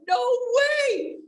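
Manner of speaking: high, shouted no way; surprised. Retold or imitated. Wife hears her eyes reversed aging, shouted disbelief. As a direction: disbelief leaps up. Reacting to amazing news.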